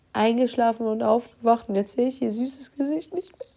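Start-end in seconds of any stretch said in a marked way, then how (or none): 1.8-3.5 crying. Real, not acted. Grieving over her dead cat.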